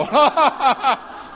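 high, loud burst of laughter; delighted burst of laughter; bright laugh. Real, not acted. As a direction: laugh out at the memory. Laughs, only one raising hand.

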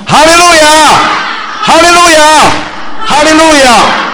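three long, high, full-voiced shouts of praise; shouted hallelujah. Real, not acted. Pastor leading the congregation in three shouts of praise, at full volume. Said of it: "我们一起喊好不好？".

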